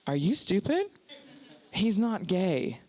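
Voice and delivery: slow, withering correction with long pauses; incredulous boss. Retold or imitated. Boss correcting her after the Zoom call, mock exasperation. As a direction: spell it out as if to an idiot.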